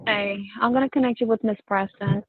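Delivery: normal talking voice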